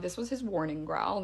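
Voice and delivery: ordinary talk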